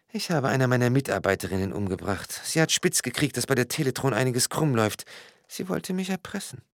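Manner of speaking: high-pitched, softened confession; confessing woman character. A narrator's reading. Frau Degen confesses in story.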